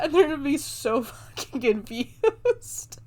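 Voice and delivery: high, slow words through laughter; cracking up at your own bit; real helpless laughter while talking; laughing. Real, not acted. Laughing at her own crying bit.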